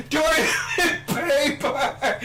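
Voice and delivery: high, loud imitation of calling out; mock desperate call. Imitating friend calling from the toilet, high mock yell. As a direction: call it out high, breaking into laughter. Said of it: "Finally, we hear Jake say".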